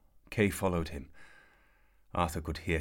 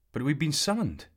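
high, louder pleading wail; 10 semitones higher, 6 dB louder than usual. A narrator's reading. Kay wailing to his father, high and pleading. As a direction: whine it like a let-down boy. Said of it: "Kay wailed".

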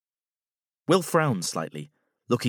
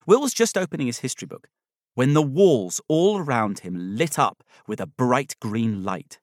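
usual speaking voice

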